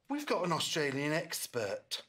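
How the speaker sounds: usual speaking voice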